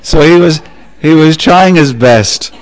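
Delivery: chuckling at the joke; laughing. Real, not acted.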